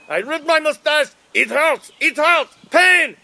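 hammed-up cries of pain; mock pain. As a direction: yelp over a ripped mustache. Ripped mustache, mock pain.

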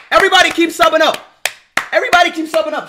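fast, high, loud rallying call. Real, not acted. Streamer urging everybody to keep subbing, calling out. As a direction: shout it out to the whole crowd. Calls out to viewers to subscribe.

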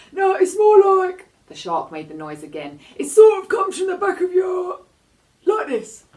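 high, loud, odd cartoon shark voice; a big shark owning up; sheepish shark. A narrator's reading. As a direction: shark admitting it was wrong to the girl.

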